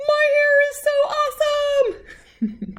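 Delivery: very high, squeaky goofy voice; a funny tiny cartoon voice; silly baby voice. Baby Einstein finally speaks, silly.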